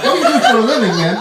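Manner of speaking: mock incredulous. Asking the rich guy what he does, loud amazed. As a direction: press him in amazement.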